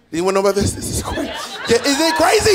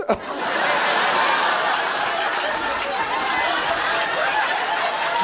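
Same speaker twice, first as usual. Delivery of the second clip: high breathless squeal of laughter; real high squealing laugh; shrieking laugh; 5 dB quieter than usual. Real, not acted.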